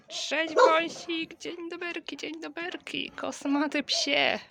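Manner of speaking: high sing-song pet voice. Real, not acted. High pet talk to dog.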